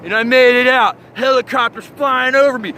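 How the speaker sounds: normal talking voice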